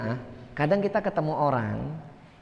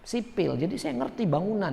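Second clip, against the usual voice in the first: boastful know-it-all; 3 semitones higher, 3 dB quieter than usual. Retold or imitated. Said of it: "Nah, ngomong kayak ini".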